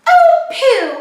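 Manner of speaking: high, loud laughing oh pooh; laughing mouse. A narrator's reading. Mouse laughing at the scared elephant. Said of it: "The mouse laughed".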